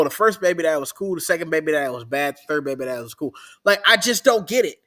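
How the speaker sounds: ordinary talk, nothing marked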